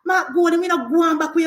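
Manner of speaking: stumbling, um-filled mimicry of a rival; Mark Golding. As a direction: imitate someone fumbling for words. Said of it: "here Mark Golding come want set back".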